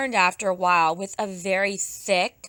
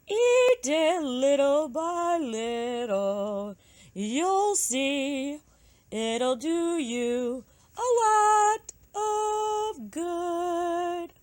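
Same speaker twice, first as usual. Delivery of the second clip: high, slow bird voice; magpie voice; 6 semitones higher, much slower than usual. Acted. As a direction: draw the words out high and slow.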